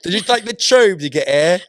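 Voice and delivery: put-on jokey British accent at a friend; mock British accent. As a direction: play a London barista in a British accent. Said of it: "accidentally do a British accent".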